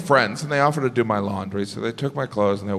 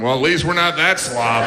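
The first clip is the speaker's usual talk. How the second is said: pigs' smug voice. Pretend. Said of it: "the pigs were looking at me".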